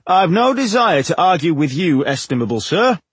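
courteous but firm dwarf. A narrator's reading. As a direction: politely stand your ground. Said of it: "he said firmly".

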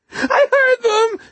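high, loud, slow terrified shriek. A narrator's reading. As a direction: scream it in terror. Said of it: "he shrieked".

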